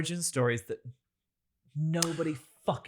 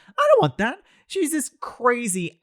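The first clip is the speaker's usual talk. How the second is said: much higher, louder protest; ranting against the Cruella backstory, much higher and louder; emphatic outburst; 8 semitones higher, 7 dB louder than usual. Real, not acted. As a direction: burst out in indignant refusal. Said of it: "I have an opinion".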